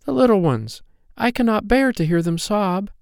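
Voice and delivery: high, pained little-fairy voice; distressed cry. A narrator's reading. Poppy Pink seeing the hungry children, distressed cry. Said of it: "cried Poppy Pink".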